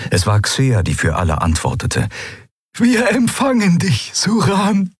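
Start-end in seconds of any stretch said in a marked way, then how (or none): 2.7-4.9 much higher, joyful Xea voice; jubilant. A narrator's reading. Xea greeting the old friend, jubilant and high. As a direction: answer him with delight. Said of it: "ihr Horn jubilierte".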